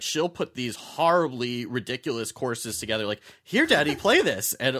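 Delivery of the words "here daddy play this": higher-pitched, louder little daughter; little dungeon master. Retold or imitated. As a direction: imitate a daughter bossing her dad. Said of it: "like, dungeon master".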